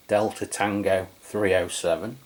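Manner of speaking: ordinary delivery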